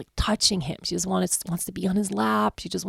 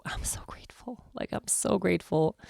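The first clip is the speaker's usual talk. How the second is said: real, whispered thanks; whispered gratitude; 7 dB quieter than usual. Real, not acted. Mother telling viewers how grateful she is, whispered. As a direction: breathe it out, moved.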